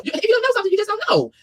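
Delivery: high, indignant lecture; exasperated squeal. Real, not acted. Scolding clueless clerks, high and indignant. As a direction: raise the voice in disbelief.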